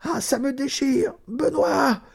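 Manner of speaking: woman in labour crying out to Benoît in agony; crying out in pain. A narrator's reading. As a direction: cry out as the pain tears through her. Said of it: "Elle se remit à crier".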